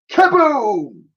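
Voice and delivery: high, loud falling cry. Acted. Lady Buckshot plunging into the Thames, cry. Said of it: "she cried".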